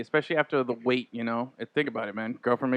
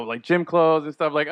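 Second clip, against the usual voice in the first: normal talking voice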